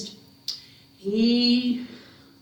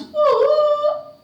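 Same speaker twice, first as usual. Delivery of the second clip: loud, high "woo" to call dogs; piercing dog call; high calling whoop; 8 dB louder than usual. Acted. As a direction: whoop loud and long on one high note. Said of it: "He called the dogs".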